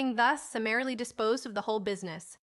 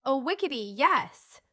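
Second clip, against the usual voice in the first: high, louder joyful yell; excited yell; 4 semitones higher, 5 dB louder than usual. A narrator's reading. Boy cries yes to moving in, excitedly. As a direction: burst out with joy.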